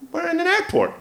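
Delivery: laughing mock bloke; mocking the threatener. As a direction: ham up the bully's warning. Retold threat, mocked.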